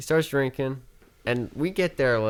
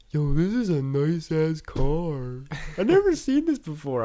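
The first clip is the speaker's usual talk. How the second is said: put-on slow then much higher, marveling drunk; drunk amazement; 7 semitones higher, 3 dB louder than usual. Drunk friend admires his own ride, slow marveling drunk. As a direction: gush like a wasted friend.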